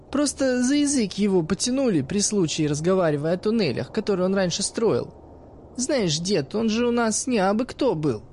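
put-on sly teenage girl talking to the old man; cheeky girl's voice. Narrator voices the girl Iskra.

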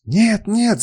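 high-pitched cry of protest; screaming. A narrator's reading. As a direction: cry out high in desperate refusal. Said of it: "закричала она".

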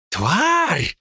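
high pained howl; howling cry. Acted. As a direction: howl out the curse. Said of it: "взвыл Ли". (взвыл от боли)

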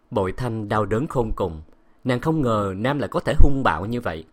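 plain reading voice

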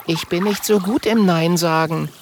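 sheepish, high-pitched admission; sheepish girl figure. A narrator's reading. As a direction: confess in a high little voice.

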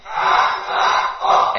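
"Halaqa" chanted loud together, drawn out slowly; whole class calls out "Halaqa" slowly; group shout. Shown as a demonstration. ("Halaqa" diserukan bersama dengan keras dan pelan)

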